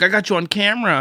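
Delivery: high, loud mocking threat; retold taunt. Imitating driver threatening to film him, high and loud.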